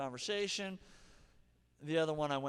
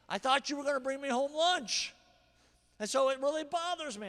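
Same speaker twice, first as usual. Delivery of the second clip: acted complaint; 9 semitones higher, 5 dB louder than usual. Shown as a demonstration.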